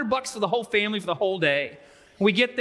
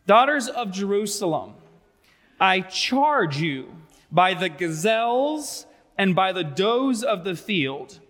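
slow, deliberate verse reading; measured reading of a love poem; dramatic scripture reading; slower than usual. Acted. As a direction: read it solemnly, pausing between phrases. Reads scripture aloud, very slowly.

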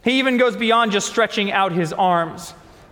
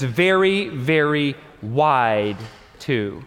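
drawn out; 7 semitones lower, much slower than usual. Shown as a demonstration. Preacher stretching the word wide.

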